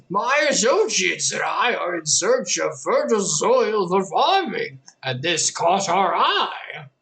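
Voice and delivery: put-on high, slow, creaky old spook; old spooky voice. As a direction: play a sinister old elder, drawn out. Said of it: "Old, an old spooky voice".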